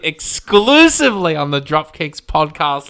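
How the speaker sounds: loud, emphatic promo voice; hype announcer. Pretend. Host hyping last year's prediction, mock-grand announcer. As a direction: proclaim it like a podcast promo.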